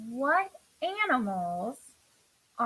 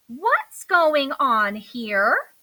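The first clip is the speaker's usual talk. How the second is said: louder and very slow demand; crying out; 8 dB louder, much slower than usual. Acted. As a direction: call out sharply, drawing it out. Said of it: "cried Mom".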